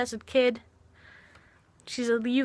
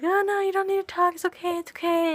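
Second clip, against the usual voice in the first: lightly mimicked high, sweet soothing; mock gentle comforter, pitched up; high sweet reassurance; 10 semitones higher than usual. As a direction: imitate her soothing in a much higher voice. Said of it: "she's just like".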